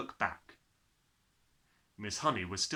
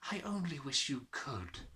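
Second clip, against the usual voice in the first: sad and gentle; 3 semitones higher, 5 dB quieter than usual. A narrator's reading. Miss Honey voiced.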